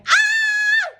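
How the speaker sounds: very high loud squeal of excitement; excited squeal. Real, not acted. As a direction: shriek with thrill. Squeal on hearing he arrived.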